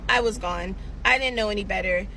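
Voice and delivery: ordinary delivery, nothing marked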